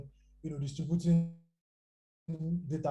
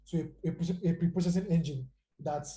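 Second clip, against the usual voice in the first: ordinary talk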